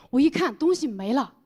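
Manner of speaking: usual speaking voice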